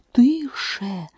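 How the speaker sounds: high, urgent rabbit hush; the rabbit's sharp scared shush; frightened shush. A narrator's reading. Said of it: "злякано цикнув на неї кролик".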